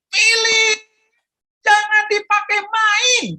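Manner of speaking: loud high telling-off; scolding mother. Acted. Mother scolding Philip for playing with the bottle, loud stern call. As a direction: scold the boy from the kitchen.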